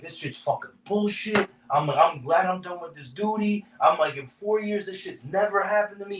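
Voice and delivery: high, strained put-on rant; staged phone rant, high and worked up; fake rant. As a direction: act exasperated to fool the boss. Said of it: "I go all out".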